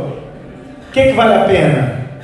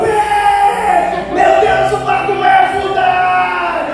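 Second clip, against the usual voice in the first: alarmed shout; 10 semitones higher, much slower than usual. Retold or imitated. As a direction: scream that the boat is sinking. Apostles shouting in the boat. (gritaria de pânico no barco)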